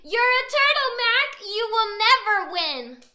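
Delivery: loud, slow schoolyard jeer; taunting yell. Acted. Bully yelling back at his friend, mocking taunt. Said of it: "he yelled back to him".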